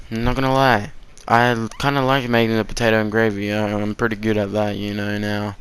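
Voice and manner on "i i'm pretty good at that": ordinary delivery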